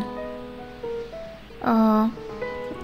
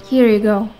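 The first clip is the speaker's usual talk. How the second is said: ordinary delivery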